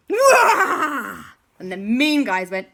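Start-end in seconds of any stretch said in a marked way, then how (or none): 0.1-1.2 loud, high, drawn-out "wah"; jump-out scare yell; sudden scare shout. Acted. Sneaking up behind the mean guys to scare them, sudden shout. Moon Landing jumps out behind villains.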